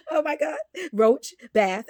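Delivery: laughing high and loud. Real, not acted. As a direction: burst out laughing while reading. Laughing at baby's word roach.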